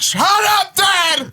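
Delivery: mock shout. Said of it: "He's like".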